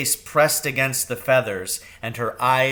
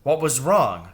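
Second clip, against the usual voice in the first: ordinary delivery, nothing marked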